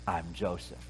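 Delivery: flat quiet reveal. Shown as a demonstration. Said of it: "I don't think he said it like that".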